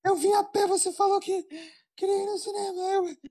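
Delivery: high stern mother voice. Acted. Mother catching her daughter at the cinema, stern high scolding. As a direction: scold her like a mum who caught you.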